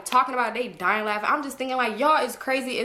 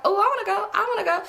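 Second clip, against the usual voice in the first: high, whiny begging voice, much higher; whiny kid begging; 11 semitones higher, faster than usual. Retold or imitated. Teen begging mom and stepdad to come, high whiny plea. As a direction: beg like a kid who wants to tag along.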